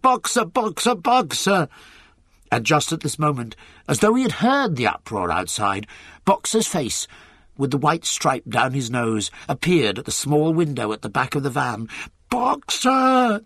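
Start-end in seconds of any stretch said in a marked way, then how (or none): 0.0-1.7 repeated high, urgent calls; Clover's anxious cries. A narrator's reading. Mare cantering after her friend's van, anxious cries. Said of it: "she cried". 12.3-13.4 high, long desperate call; Clover's terrible cry. A narrator's reading. Mare calling into the departing van, terrible cry. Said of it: "cried Clover in a terrible voice".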